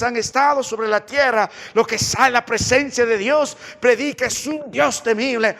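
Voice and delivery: loud, high, driving sermon delivery; fiery preacher in full cry; full-force preaching. Real, not acted. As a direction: thunder the scriptures out to the congregation.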